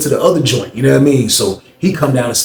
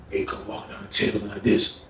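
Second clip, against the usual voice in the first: ordinary delivery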